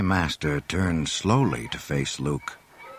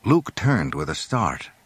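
ordinary delivery

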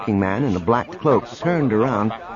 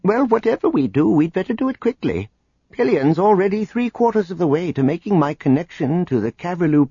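higher, worried and hurried; anxious; 8 semitones higher than usual. Acted. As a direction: voice tight with worry. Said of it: "could not hide his anxiety".

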